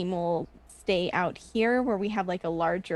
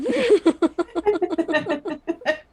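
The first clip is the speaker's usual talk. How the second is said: laughing; 10 semitones higher, 4 dB louder than usual. Real, not acted. Laughing after accent.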